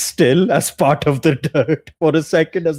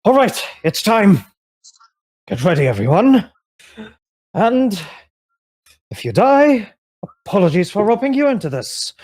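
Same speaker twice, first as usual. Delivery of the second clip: raised, urgent leader voice rallying the group; rally them, higher and pushed; Dagon's voice; 8 semitones higher than usual. Acted. Dagon tells the party to get ready, urgent. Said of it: "he's like".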